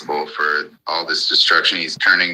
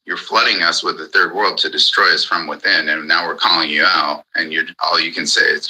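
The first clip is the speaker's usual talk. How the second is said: usual speaking voice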